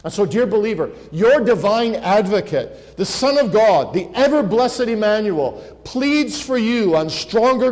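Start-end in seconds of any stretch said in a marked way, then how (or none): none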